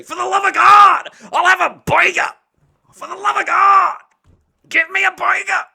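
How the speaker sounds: put-on very high, shouted New York accent; intense mock begging. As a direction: bellow a burger order as a desperate, hammed-up plea. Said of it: "orders burgers like that, with that intensity".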